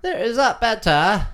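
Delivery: loud, high-pitched mock check; silly high voice. Host rechecking the microphone with listeners, silly high loud voice. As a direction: pipe it up high and loud, playfully.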